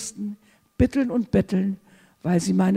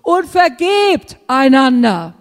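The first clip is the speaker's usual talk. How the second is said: slow, loud, "vergebt" hammered; insistent scripture stress; emphatic command; 3 semitones higher, 8 dB louder, slower than usual. Shown as a demonstration. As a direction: press the command home. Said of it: "Wie oft das erwähnt wird".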